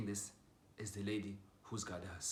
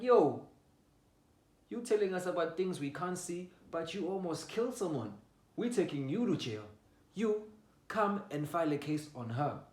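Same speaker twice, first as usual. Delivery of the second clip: raised, higher-pitched voice of retold police; police confrontation; 8 semitones higher, 7 dB louder than usual. Police turning on mother over the case, loud accusation. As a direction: call her out loudly, accusing.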